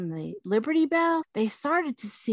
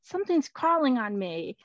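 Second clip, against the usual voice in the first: high-pitched bewildered exclamation; startled man's squeamish cry; startled alarm; 6 semitones higher than usual. Acted. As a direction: jump in pitch, as if something crawls on you. Man swats at writing on arm.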